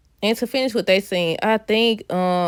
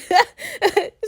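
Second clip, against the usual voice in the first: laugh; 12 semitones higher than usual. Real, not acted. Laughing after the mock line.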